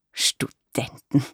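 aghast, breathed very slowly in a whisper; appalled, drawn-out whisper; horrified whisper. A narrator's reading. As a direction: gasp the word out in shock. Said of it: "rief sie entsetzt aus".

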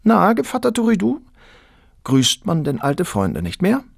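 a married woman teasing Turiddu, lightly voiced. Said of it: "sprach Turiddu eines Tages an".

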